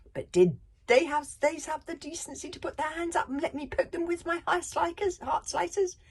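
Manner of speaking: high, loud whiny outrage; hissing, whiny outrage. Acted. Librarian whining in outrage about the thieves, high. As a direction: whine it out, offended. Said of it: "His hissing, whiny voice rose in outrage".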